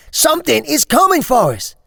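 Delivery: put-on boy pleading loudly with the stranger; urgent shout. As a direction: yell it desperately. Boy urging stranger in danger.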